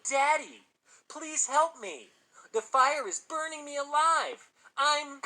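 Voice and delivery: slow, high, loud fake plea for help; drawn-out imitation of a screaming boy, cut off mid-scream; mock scream. A narrator's reading. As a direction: wail it out like a burning child, hammed up. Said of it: "Arnold stopped in mid-scream".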